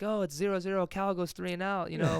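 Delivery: rising, hyped play-by-play call, pitch climbing high; pretend sports announcer. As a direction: call your own punt return like a TV announcer in the shower. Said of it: "I'm pretending to be the, uh, the announcer".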